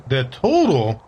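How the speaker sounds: normal talking voice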